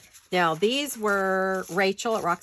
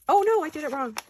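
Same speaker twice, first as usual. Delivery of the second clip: ordinary talk, nothing marked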